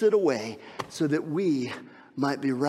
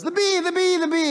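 put-on scared child; 5 dB louder than usual. Daughter cries as bee escapes.

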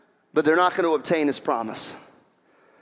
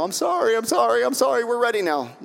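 lightly put-on pleading, slightly raised pitch; mock apology; 6 semitones higher than usual. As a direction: plead a hollow sorry, mockingly. Acting the people's hollow apology.

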